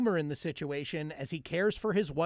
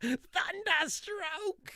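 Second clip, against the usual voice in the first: slow, high stretched echo; high drawn-out; 14 semitones higher, much slower than usual. Acted. Echoing the silly word.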